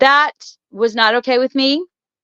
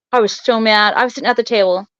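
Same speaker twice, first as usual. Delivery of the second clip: ordinary talk, nothing marked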